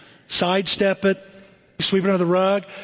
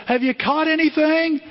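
high-pitched call across the water; 9 semitones higher than usual. Acted. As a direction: call to a boat from the shore. Man on shore calls to boat.

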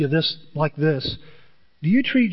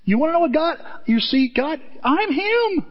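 a hint of Jesus, pitched much higher; lightly voiced Jesus; 10 semitones higher than usual. Pastor voicing Jesus to the congregation, high declaration. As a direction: proclaim it as Jesus, high and bold.

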